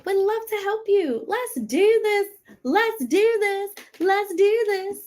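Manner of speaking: high, pumped-up rallying call; hyped cheer. Acted. Host rallying viewers to join her bootcamp, high excited cheer. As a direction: fire them up like a cheerleader.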